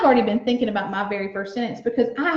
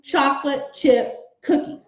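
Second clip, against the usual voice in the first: put-on each word said slowly and clearly for young writers; slow model sentence; slower than usual. Shown as a demonstration. Teacher to class, saying the model sentence slowly. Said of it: "So I'm gonna say".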